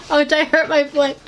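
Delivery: ordinary delivery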